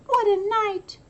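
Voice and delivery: high, fussy mole voice; muttering mole. Acted. Mole complains to the crowd about the night, high and fussy. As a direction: fuss about the weather like a little mole. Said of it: "Mole mutters".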